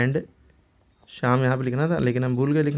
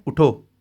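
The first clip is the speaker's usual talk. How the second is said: a louder, standalone "उठो"; stop after उठो; 6 dB louder, much slower than usual. Shown as a demonstration. Teacher showing the full stop after उठो, said louder and cut off. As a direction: punch उठो out alone and stop. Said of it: "उठो के बाद रुक गए".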